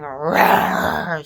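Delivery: a big imitated growl of release; men roaring. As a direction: roar it out like the men in the ceremony. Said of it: "I started hearing men go".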